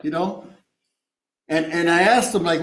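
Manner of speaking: normal talking voice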